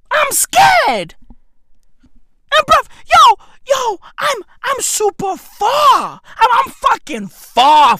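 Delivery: high, loud mock panic; hammed-up panic. Telling viewers he is scared in hammed-up high panic. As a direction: squeal it like you're hamming up fear.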